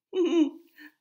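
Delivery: loud, high laugh bursting out; burst of laughter. A narrator's reading. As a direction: let a big laugh burst out. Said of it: "Hex laughed".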